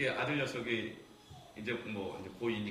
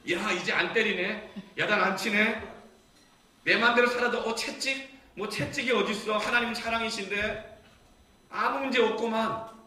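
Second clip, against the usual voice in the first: loud, high, cocky crowing; brazen mock child; 11 semitones higher, 11 dB louder than usual. Child gloats that dad no longer punishes, brazen imitation. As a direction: act out a kid gleefully getting away with it. Acts out a child exploiting leniency.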